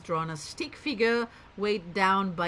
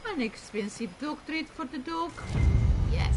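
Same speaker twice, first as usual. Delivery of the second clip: higher-pitched mock Russian accent; fake Russian accent; 7 semitones higher, 4 dB louder than usual. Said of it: "sounded Russian. Fake Russian".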